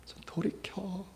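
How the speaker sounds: soft whispered call to turn back; whispered plea. Acted. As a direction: breathe it as an intimate plea.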